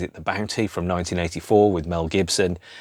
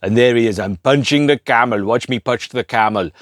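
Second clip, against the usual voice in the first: put-on louder, lightly done thick Austrian accent; a mock Austrian strongman voice, louder; hint of a thick Austrian accent, louder; Arnold Schwarzenegger Austrian accent; 4 semitones higher, 6 dB louder than usual. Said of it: "in his thick Austrian accent".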